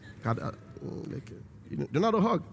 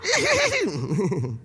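demonstrated hearty laugh; 4 semitones lower than usual. Shown as a demonstration. Preacher showing men how to laugh, demonstrated hearty laugh. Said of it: "Not to laugh".

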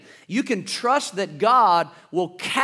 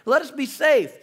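lightly voiced, higher, complaining crowd; a hint of a whiny we-told-you-so; whining Israelites; 8 semitones higher than usual. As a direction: Israelites pleading to be left alone, mildly whiny. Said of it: "It says".

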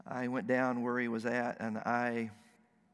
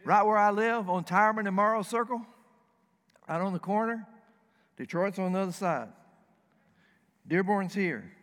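excited loud; 5 semitones higher, 7 dB louder, faster than usual. Real, not acted. Preacher excited describing his neighborhood.

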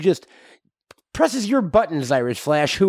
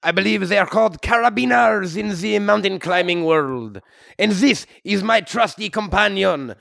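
put-on comic French-Canadian accent; hammy Québécois hero voice. French-Canadian Wolverine character.

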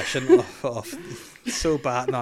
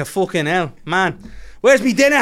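loud, high Dublin gangster bark; Love Hate Dublin accent; 6 semitones higher, 6 dB louder than usual. Retold or imitated. Walking round the house demanding dinner, loud Dublin accent. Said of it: "we've been watching Love Hate".

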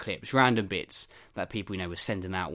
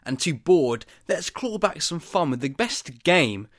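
higher, louder host hype; upbeat segue; 4 semitones higher, 6 dB louder than usual. Real, not acted. Host leading listeners into the podcast game, excited and loud.

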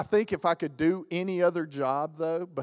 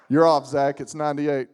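weather guy correcting; 10 dB louder than usual. Pretend. Weather guy quoted loud.

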